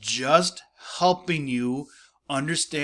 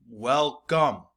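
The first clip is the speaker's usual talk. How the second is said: syllable by syllable. Shown as a demonstration. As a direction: welcome split into WEL-COME. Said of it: "two-syllable words".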